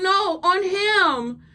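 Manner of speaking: high, very slow mock insistence; insistent pleading. Woman demanding to sit on him, high drawn-out mock plea. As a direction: draw it out as a clingy demand. Acting her insisting on his lap.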